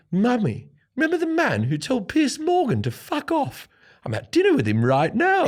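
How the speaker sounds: high-pitched posh English impression; posh English wife. Comic imitating his wife phoning her mum, posh English voice. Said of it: "my wife's quite posh English".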